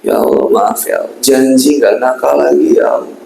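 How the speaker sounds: demonstrating heartfelt, whining repentance; whimpering plea. Shown as a demonstration. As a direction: plead like a sorry child, voice fading away. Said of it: "Yang penghayatannya, ekspresinya gitu mana".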